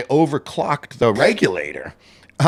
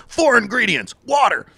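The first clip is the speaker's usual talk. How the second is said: much higher and louder, yelled like a punk singer; punk rock shout; 13 semitones higher, 4 dB louder than usual. Pretend. Pretend punk rock bakery pitch, yelled. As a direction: belt the ingredient list like a punk chorus. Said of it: "Dino's Punk Rock Sourdough".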